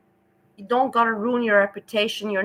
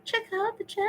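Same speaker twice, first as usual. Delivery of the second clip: high laugh; 5 dB quieter than usual. Real, not acted. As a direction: laugh high, then speak through it softly. Laughing while reading chat.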